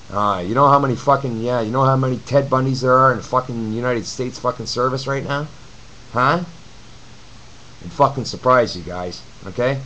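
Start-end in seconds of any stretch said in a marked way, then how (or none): none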